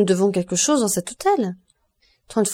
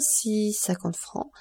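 much softer, said while writing; murmured note; 8 dB quieter, much slower than usual. A narrator's reading. Son noting the sum on paper, softly. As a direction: repeat it under the breath. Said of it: "Albert prit une plume et écrivit".

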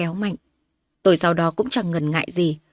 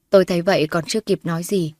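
plain reading voice

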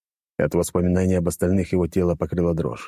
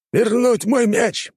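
villain command; 4 dB louder, much slower than usual. Acted. Villain's afterthought order.

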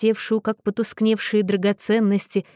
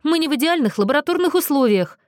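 higher, quick exasperated retort; restrained bark, pitched up and fast; snapping; 7 semitones higher, faster than usual. A narrator's reading. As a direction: snap back impatiently, without full volume. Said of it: "рявкнул Джеймс".

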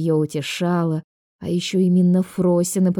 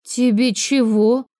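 slow, higher, wary question; suspicious; 5 semitones higher, slower than usual. A narrator's reading. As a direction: draw it out with suspicion. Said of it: "с подозрением поинтересовалась". (с подозрением)

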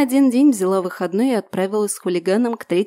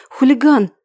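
calling out; 4 semitones higher, 6 dB louder than usual. Acted. She calls her dog.

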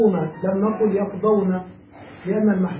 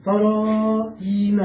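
slow model form; much slower than usual. Shown as a demonstration. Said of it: "ومع ياء المخاطبة".

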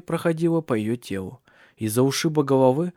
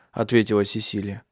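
ordinary delivery

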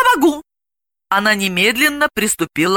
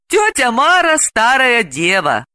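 slow, raised, malicious reminder; gloating; 7 semitones higher, slower than usual. A narrator's reading. Delila needles her friend about Aunt Mara, gloating. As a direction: draw it out spitefully. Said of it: "Далила злорадно напомнила".

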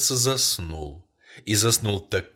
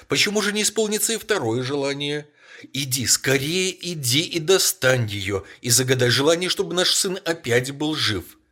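higher and louder, trembling with agitation; trembling shout; 6 semitones higher, 6 dB louder than usual. A narrator's reading. Wife cries out to her husband, trembling with agitation. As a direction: cry it out, shaking with emotion. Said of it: "закричала жена, вся дрожа от волнения".

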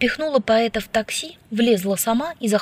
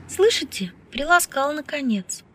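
high-pitched confidential comment; confiding aside; 6 semitones higher than usual. A narrator's reading. Woman confiding in the professor about her friend, confiding. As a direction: share it as an aside, lifted in pitch. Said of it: "доверительно откомментировала профессору".

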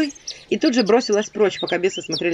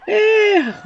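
sigh; 4 dB louder than usual. Acted. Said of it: "вздохнули бесы".

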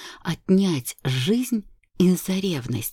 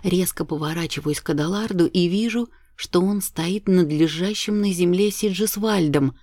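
ordinary delivery, nothing marked

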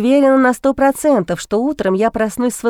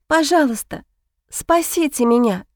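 desperate whisper; 4 semitones higher than usual. A narrator's reading. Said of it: "прошептала я".

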